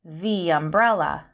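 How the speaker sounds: THE as "thee" before umbrella; long THE. Shown as a demonstration. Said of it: "we would say".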